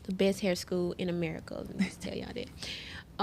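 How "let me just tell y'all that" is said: whispered aside. Real, not acted. Aside to the audience.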